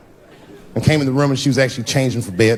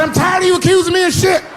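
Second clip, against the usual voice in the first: defensive outburst. Retold or imitated. Husband snaps at wife over accusation, high and indignant. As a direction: bluster to dodge the blame.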